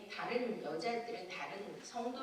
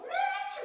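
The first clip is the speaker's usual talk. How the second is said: a loud mock wail, much higher; crying-out wail; 6 dB louder, much slower than usual. Retelling her night in tongues, a loud high wailing cry. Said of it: "막 이러더니".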